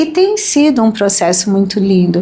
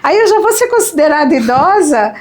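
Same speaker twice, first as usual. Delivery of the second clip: high, mock-startled question; surprised to be called elderly, told playfully; retold astonishment; 8 semitones higher than usual. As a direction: replay the shock of turning elderly. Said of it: "Eu falei pra ele".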